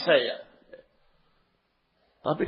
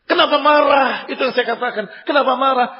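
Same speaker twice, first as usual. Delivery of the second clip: loud, much higher rhetorical demand; raised preaching challenge; emphatic challenge; 9 semitones higher, 8 dB louder than usual. Real, not acted. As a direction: call the audience out with force.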